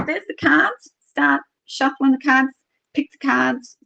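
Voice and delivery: high-pitched retold instructions; imitated urging. Tarot reader to client before reading, high urgent prompting. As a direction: mimic the reader hurrying things along, pitched well up.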